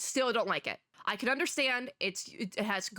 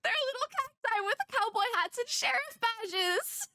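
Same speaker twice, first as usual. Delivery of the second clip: delighted squealing. Real, not acted. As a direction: gush over something unbearably cute. Gushing over cute little cacti.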